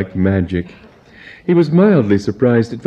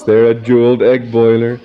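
ordinary delivery

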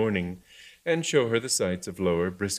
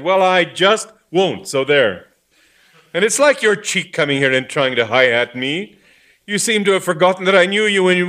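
angry threat; 10 semitones higher, 10 dB louder than usual. Acted. Vicar threatening in quarrel.

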